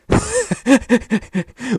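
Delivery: high, rueful laugh at his own bad luck; 1 exasperated laugh. Real, not acted. Streamer laughing at a ruined combo, rueful laugh. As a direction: laugh it off in disbelief.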